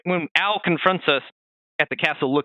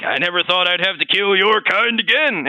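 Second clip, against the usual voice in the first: put-on grumpy old man; 5 semitones higher, 5 dB louder than usual. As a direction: shake your fist at the kids on your lawn. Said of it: "He's like".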